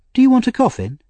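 put-on high little-boy question; innocent. A narrator's reading. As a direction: ask naively in a small child's voice. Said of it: "asked Oliver innocently".

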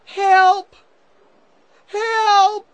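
high, drawn-out yell for help; loud shout. A narrator's reading. Tom calling for rescue in the caves, loud shout. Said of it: "Tom shouted loudly".

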